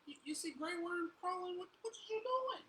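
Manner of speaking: high mock voice to the crawling baby; teasing baby talk. Dad teasing the crawling baby in a high voice. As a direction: coo at him like a baby.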